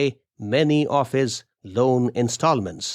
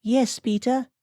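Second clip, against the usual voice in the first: very high-pitched female character voice; high-voiced wife replying; meek wife; 12 semitones higher than usual. A narrator's reading. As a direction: answer in a high, put-on woman's voice. Amelia answers questioning husband.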